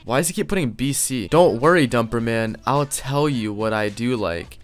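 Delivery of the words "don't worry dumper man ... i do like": plain reading voice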